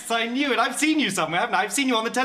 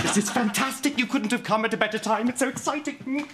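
gushing, rising showbiz thrill; carried-away excitement; 4 semitones higher than usual. Acted. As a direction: let the excitement run away with you. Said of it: "my showbiz emotions just ran away".

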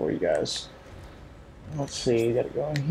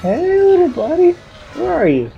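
much higher, singsong taunting voice; creepy sing-song; 5 dB louder than usual. Pretend. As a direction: coax it like a creepy game.

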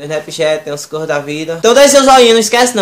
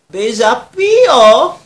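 lazy drawl; 6 semitones higher, 5 dB louder, much slower than usual. Acted. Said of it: "namorada preguiçosa".

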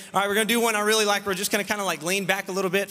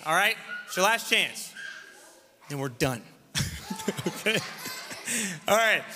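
ordinary talk, nothing marked

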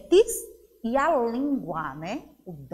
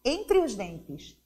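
normal talking voice

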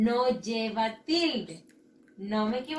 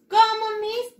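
put-on very high, loud child's question; baffled pupil; 11 semitones higher, 8 dB louder, slower than usual. Pupil asking Miss to repeat, baffled. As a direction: squeal it as a puzzled pupil.

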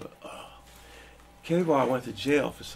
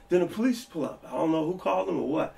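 normal talking voice

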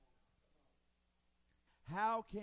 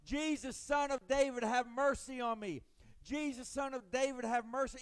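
desperate loud cry for mercy, pitched up high; blind man hollering; 7 semitones higher, 8 dB louder than usual. Retold or imitated. Blind man by the road hollering to Jesus, loud and pleading. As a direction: call out over the crowd to be heard.

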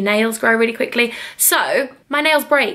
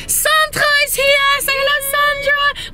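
showing Sandra to the viewers, much higher excited; excited announce. Real, not acted. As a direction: announce her arrival with glee.

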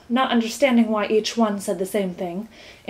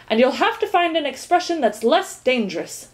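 higher, mildly angry reprimand; 6 semitones higher than usual. A narrator's reading. Minister reprimands the earl, angrily. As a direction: lecture him crossly. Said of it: "said the minister angrily".

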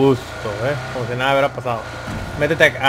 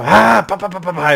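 imitando enojo; 4 semitones higher, 9 dB louder than usual. Retold or imitated. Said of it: "se enfadó y dijo".

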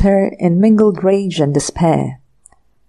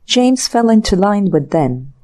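plain reading voice

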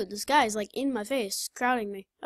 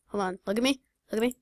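usual speaking voice

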